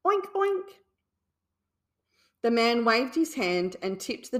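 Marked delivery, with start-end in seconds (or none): 0.1-0.7 high oinking pig voice; pig grunt. A narrator's reading. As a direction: oink like a piglet. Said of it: "a great big grunt".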